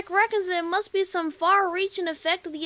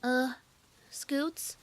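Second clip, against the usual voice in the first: much softer, lower, wary; uneasy hush; 8 semitones lower, 8 dB quieter than usual. Acted. Apple Bloom quietly alerting Scootaloo, uneasy and soft. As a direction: ask it hushed and uneasy.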